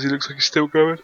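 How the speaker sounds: talking through a yawn; words stretched by a yawn. Real, not acted. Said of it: "Sorry, I yawned with that".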